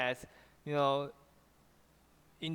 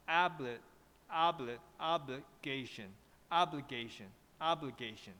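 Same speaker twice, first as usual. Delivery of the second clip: teacher's careful model of "obligation", level then falling; flat, then drop; 2 semitones lower, 4 dB quieter than usual. Shown as a demonstration. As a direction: keep "obli" flat and drop the tone on "gation". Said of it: "It's like a flat and drop".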